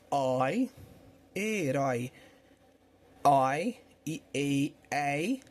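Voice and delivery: oi, herói, ei with open vowels, drawn out; open diphthongs. Shown as a demonstration. Teacher sounding out the open diphthongs, slow demonstration. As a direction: sound out each "oi" and "ei" slowly, vowel wide open. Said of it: "Ditongos abertos".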